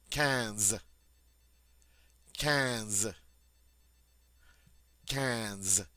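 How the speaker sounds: quinze with a nasal vowel; French quinze. Shown as a demonstration. Teacher modeling quinze for students, nasal French vowel.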